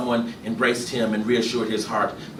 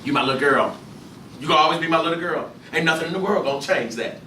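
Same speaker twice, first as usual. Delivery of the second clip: stubborn father; 6 semitones higher, 3 dB louder, faster than usual. Acted. Gus voiced in story.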